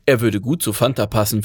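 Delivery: ordinary delivery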